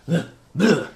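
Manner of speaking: two short disgusted grunts, acted out; stumbling character's grunts; tripping grunts. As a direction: grunt "ugh" as if tripping over. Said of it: "he trips and goes".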